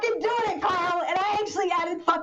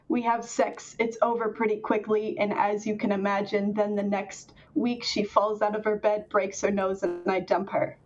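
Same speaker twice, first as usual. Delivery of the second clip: lower, deadpan monotone reading; flat deadpan read, no color in the voice; 8 semitones lower than usual. Shown as a demonstration. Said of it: "supposed to read in a monotone voice".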